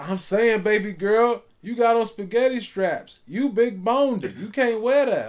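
imitating a pushy guy. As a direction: lay it on like a smug flirt. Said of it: "he was like".

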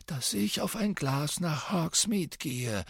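put-on light, slow old wizard voice; said lightly. A narrator's reading. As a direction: toss it off casually, unhurried. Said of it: "sagte Dumbledore leichthin".

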